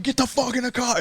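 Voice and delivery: high, strained mock yell without volume; high-pitched imitation of barking an order; retold yell. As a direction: yell an urgent order, scaled down for retelling. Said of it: "I, I yell".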